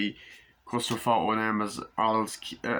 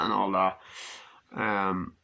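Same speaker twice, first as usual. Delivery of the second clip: ordinary talk, nothing marked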